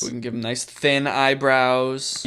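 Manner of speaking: ordinary talk, nothing marked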